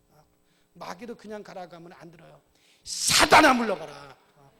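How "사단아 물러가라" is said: commanding shout. Pretend. Preacher rebuking Satan, loud commanding shout. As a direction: cast out the devil with a roar. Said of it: "물리쳐야 됩니다".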